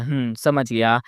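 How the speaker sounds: low, pensive hum of understanding; thoughtful. A narrator's reading. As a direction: mull it over, voice dropped. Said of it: "सोचता हुआ कहता है".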